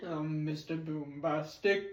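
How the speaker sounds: deep, slow crooning impression. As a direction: drop low and draw the words out. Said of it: "he's like".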